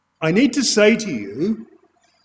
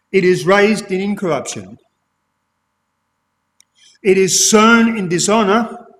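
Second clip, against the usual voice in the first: scripture reading. Acted. Reads scripture to congregation.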